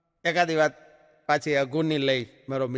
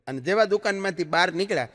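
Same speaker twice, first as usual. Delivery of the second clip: normal talking voice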